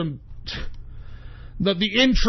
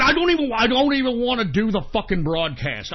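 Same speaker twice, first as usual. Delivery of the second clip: usual speaking voice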